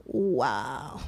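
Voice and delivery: low, soft "Wow"; awed hush. Real, not acted. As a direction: breathe out the "Wow" in quiet awe. Reacts to God's answer. (asombro callado, bajo y suave)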